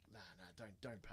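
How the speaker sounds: very low, hushed retold advice; hushed urging. Mate quietly urging his friend not to pay, hushed. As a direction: murmur it under your breath.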